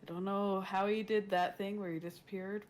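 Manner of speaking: normal talking voice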